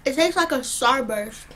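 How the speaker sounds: chewing candy while talking; talking around candy; mouth full. Real, not acted. Seen 1: Candy put in mouth at -5; closed-lip chewing while talking.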